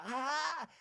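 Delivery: high, slightly smoky mock vocal sound; a light raspy squeak up high; Heather's smoky high voice. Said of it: "she sometimes on the higher pitch of her voice, she'll go".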